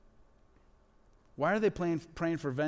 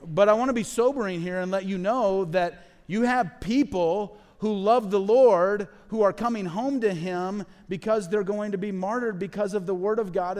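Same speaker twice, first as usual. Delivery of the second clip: earnest sobering warning; 4 semitones higher, 7 dB louder than usual. Real, not acted. Sober pastoral emphasis.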